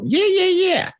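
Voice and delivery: very high-pitched, drawn-out mock liar; John Lovitz impression. Body-language analyst mimicking a comedian's catchphrase, squeaky high liar voice. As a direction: pitch it way up like a comic fibber. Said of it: "John Lovitz who made an entire career".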